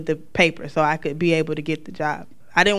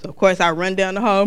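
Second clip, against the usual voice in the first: normal talking voice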